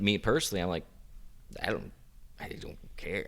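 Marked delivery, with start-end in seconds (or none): 1.6-3.2 breathy whisper, much softer, brushing it off; whispered shrug. Real, not acted. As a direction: whisper it like it really doesn't matter. Says she doesn't care.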